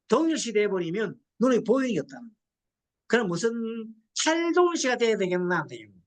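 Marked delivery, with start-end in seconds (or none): none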